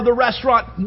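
usual speaking voice

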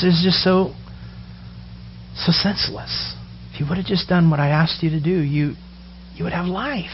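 sorrowful hush. Acted. Jesus mourning humanity's choice, hushed lament. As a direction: breathe it out in grief. Jesus grieving over sin.

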